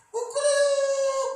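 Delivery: piercingly high, loud cock-crow; rooster crow. Shown as a demonstration. Preacher imitating the morning rooster, loud crowing. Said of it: "kalau pagi kan gitu ya".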